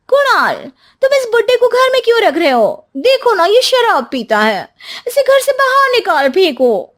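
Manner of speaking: high, sharp scolding wife voice; shrill young wife. Acted. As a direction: nag him high and loud to throw the old man out. Wife demands old man be thrown out.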